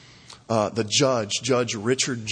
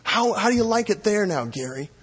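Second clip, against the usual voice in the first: higher taunting question; mocking; 7 semitones higher than usual. Preacher imitating families mocking the killer, taunting. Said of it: "they're mocking him".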